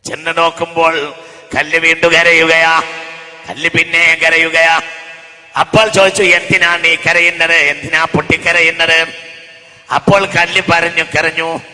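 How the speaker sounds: ringing sermon voice; full-force preaching. Acted. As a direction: preach it to the hall.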